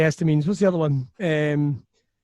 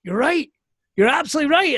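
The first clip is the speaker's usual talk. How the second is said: higher, louder hearty agreement; Piper agreeing; 9 semitones higher, 5 dB louder than usual. Retold or imitated. Wrestler Piper praises the ending idea, higher and louder. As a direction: boom out the approval like a veteran wrestler. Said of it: "he'd be like".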